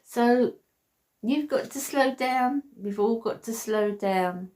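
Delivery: ordinary talk, nothing marked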